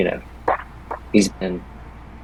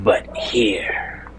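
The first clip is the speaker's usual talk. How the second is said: barely voiced, strained whisper; struggling to speak. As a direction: force out a first word after ages of silence. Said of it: "almost illegibly out".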